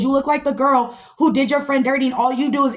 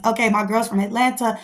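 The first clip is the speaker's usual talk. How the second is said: ordinary talk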